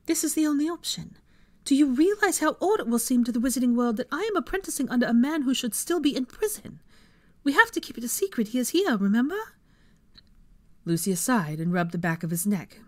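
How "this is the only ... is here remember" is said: high-pitched, indignant woman's voice; huffy. A narrator's reading. Hermione objecting to the apprenticeship, huffy. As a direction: argue it hotly, pitch up throughout. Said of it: "huffed and continued to glare".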